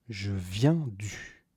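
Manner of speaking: usual speaking voice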